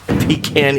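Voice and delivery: pecan with a long 'ee' first; pecan as PEE-can. Shown as a demonstration. Equating it with a chamber pot, pecan as PEE-can. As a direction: say pecan like 'pee' plus 'can'. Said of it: "Something people pee in".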